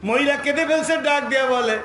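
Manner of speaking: high, loud chanted sermon; full-force preaching. Acted. Preacher relaying the refusal of battle permission, full-force chant. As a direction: cry out the story in a ringing chant.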